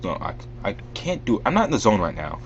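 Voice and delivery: usual speaking voice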